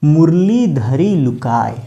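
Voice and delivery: slow, drawn-out recital of a couplet; verse recitation. Shown as a demonstration. Teacher quotes a couplet as an example, slow recitation. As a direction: recite the verse slowly, stretching the long vowels. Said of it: "कहा गया है".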